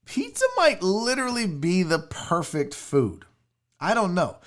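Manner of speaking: usual speaking voice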